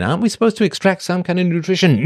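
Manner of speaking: ordinary talk, nothing marked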